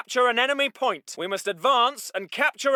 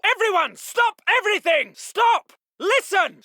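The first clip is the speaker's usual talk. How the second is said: high, urgent shout; shouted halt; 8 semitones higher, 4 dB louder than usual. Acted. Officer halting everyone at once, shouting. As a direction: yell to stop them all. Officer halts his men.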